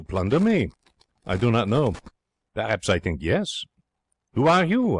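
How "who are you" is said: high, loud frightened call into the dark; startled call. A narrator's reading. Jean calls out to the unseen intruder, frightened and loud. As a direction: demand it out of fear. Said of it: "I have asked".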